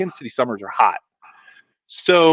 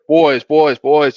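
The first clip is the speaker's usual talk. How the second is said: louder North Carolina coach impression; North Carolina accent; 6 dB louder, much slower than usual. Coach halting his players on the path, imitated North Carolina accent. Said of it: "I'll try to emulate".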